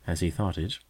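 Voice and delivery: usual speaking voice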